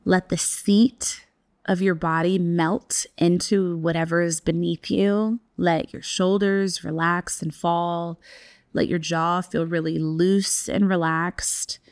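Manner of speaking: slow, hushed relaxation guide voice. Acted. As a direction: breathe the words out gently, half-whispered. Guided relaxation, jaw loosening.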